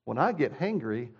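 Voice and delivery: normal talking voice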